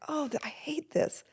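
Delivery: mild dread. Retold or imitated. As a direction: say it like you wish you didn't have to. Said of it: "I'm like".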